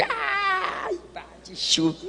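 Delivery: put-on high crying wail of a child throwing a fit; child's tantrum. Preacher mimicking a child's crying tantrum. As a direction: act out a kid wailing on the floor.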